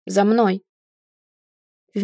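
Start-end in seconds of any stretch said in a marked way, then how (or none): 0.0-0.6 firm, irritated command; mildly roared command; barked order. A narrator's reading. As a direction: order them to follow, brusquely. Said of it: "взревел красавчик".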